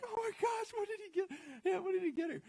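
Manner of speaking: put-on high, giddy girlish gush; excited. Audience imagining the gift, giddy high gush. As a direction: squeal it like a girl dying to know.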